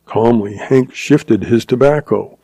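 plain reading voice

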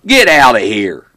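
loud, slow holler; commanding shout; yelling back. A narrator's reading. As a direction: yell it back, drawing the words out. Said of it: "turned around and yelled back".

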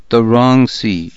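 usual speaking voice

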